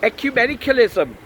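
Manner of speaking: high, loud Welsh priest voice; indignant, quick Welsh delivery; Welsh accent. A narrator's reading. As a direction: fire it off confidently in a Welsh accent. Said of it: "trying to do a Welsh accent".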